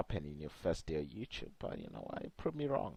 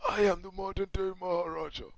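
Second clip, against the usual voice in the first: lightly mocking a smoky-voiced wrestler impression; smoker's voice imitation; 9 semitones higher than usual. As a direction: copy his friend's raspy Maharaja line, a touch higher. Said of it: "his voice sounds like he's smoking too much".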